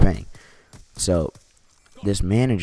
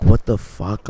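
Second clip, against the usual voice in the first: louder, higher mock yell; retold yelling; 4 semitones higher, 8 dB louder, slower than usual. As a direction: burst out in imitated outrage. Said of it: "I'm, like, yelling at this dude".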